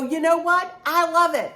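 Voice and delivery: high, loud and proud; a beaming, bold comeback; defiant joy. Retold or imitated. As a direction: throw it back at the critics with delight. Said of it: "And I'd go".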